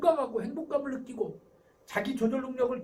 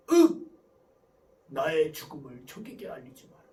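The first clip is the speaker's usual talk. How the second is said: loud groan, then low dying words; 이순신 장군; 7 semitones lower, 6 dB louder than usual. Acted. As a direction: act out a general's mock last command. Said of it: "이순신 장군 흉내를 내잖아요". (죽어가는 장군처럼 비장하게)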